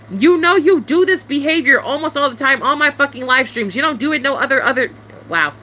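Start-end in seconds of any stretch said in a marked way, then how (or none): none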